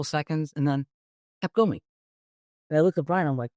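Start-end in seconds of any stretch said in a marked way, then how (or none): none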